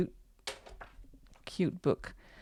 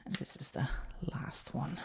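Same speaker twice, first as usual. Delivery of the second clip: whispering; 4 semitones lower, 12 dB quieter than usual. Real, not acted. Whispered aside showing last page.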